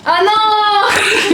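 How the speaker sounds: high, loud laughing no; laughing shout. Real, not acted. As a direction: burst out laughing. Friends burst out laughing.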